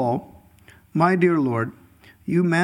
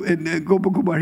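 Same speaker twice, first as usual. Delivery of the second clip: normal talking voice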